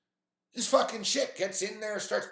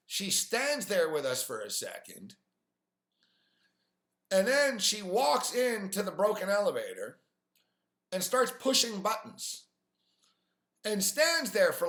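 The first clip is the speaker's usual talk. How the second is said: normal talking voice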